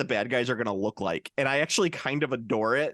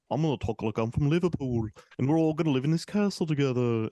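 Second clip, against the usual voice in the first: put-on Liverpudlian accent; Liverpool accent. Imitating a cast member from Liverpool, Liverpool accent. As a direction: talk like a mock Scouser. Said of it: "you have a person like".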